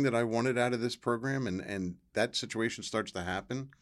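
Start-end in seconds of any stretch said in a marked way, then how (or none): none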